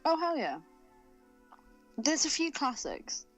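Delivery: normal talking voice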